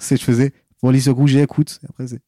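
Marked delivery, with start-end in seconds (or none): 0.8-1.8 louder put-on Antillean accent; phone operator in a Creole accent. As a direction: announce it with an Antillean lilt. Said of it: "parler avec l'accent créole".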